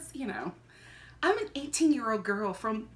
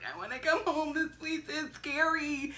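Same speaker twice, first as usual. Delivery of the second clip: small, soft mock crying voice; retold tearful call; 4 semitones higher, 7 dB quieter than usual. Teenager calling mom from New York, retold tearfully. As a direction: imitate a scared, weepy kid on the phone. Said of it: "I called my mom crying".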